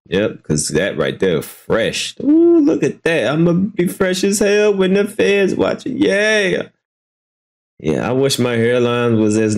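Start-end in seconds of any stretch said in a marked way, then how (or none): 2.2-6.7 excited teasing; teasing excitement. Real, not acted. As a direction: hype it up mockingly. Teasing exclaim over hairline.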